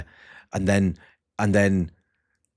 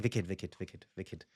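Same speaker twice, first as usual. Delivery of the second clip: mumbled brush-off; 4 semitones lower, 15 dB quieter than usual. Retold or imitated. Mumbling reply to inmates.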